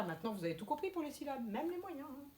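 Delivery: usual speaking voice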